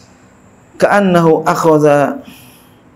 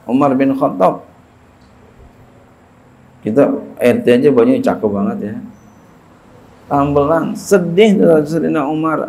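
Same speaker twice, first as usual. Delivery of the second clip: ordinary talk, nothing marked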